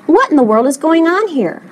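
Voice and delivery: mildly scandalized demand; shocked cry. A narrator's reading. Shocked parents question the construction, crying out. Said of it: "they cried".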